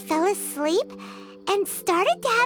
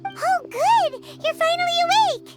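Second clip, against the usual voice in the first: higher, quick burst of relief; relieved exclamation; 5 semitones higher than usual. Acted. Companion greeting a sleepwalker who finally wakes, relieved. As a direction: exclaim brightly, relieved and excited.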